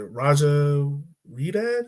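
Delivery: ordinary talk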